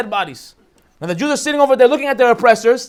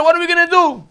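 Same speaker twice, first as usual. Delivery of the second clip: higher, pleading call to Moshe; panicked cry; 6 semitones higher than usual. Acted. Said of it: "they scream to Moshe".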